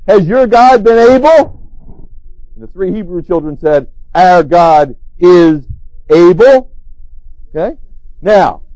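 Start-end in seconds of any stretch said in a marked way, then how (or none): 0.1-2.1 slow, drawn-out question with a rise. Retold or imitated. King's doubtful question to Daniel, slow and rising. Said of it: "Darius's question is this". 4.1-6.7 slow, deliberate, louder on able; slow firm declaration. Retold or imitated. Hebrew children answering the king, slow and firm.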